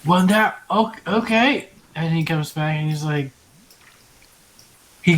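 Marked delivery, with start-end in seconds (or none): none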